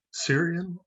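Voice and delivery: ordinary talk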